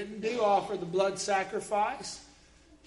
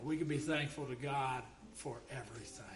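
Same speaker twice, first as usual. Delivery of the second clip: usual speaking voice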